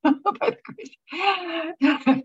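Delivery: high, slow, half-laughing half-crying; choked up with a laugh, drawn out slowly; laughing through tears. Real, not acted. Woman thanking God for grace, laughing through tears.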